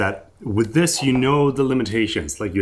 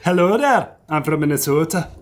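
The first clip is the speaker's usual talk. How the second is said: mock Minnesota accent; 7 semitones higher than usual. Teasing his friend about home, a hammed-up Minnesota accent. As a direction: greet them like a cheery Minnesotan. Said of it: "is that how you say it".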